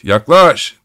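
high, loud call; shouting. A narrator's reading. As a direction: shout the word yaklaş up and out. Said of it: "diye haykırıyordu".

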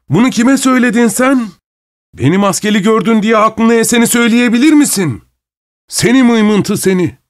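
high-pitched, indignant tirade; masked man's high, blustering outburst; outraged bully. A narrator's reading. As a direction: throw them all out in a fury. Masked man explodes at the insult.

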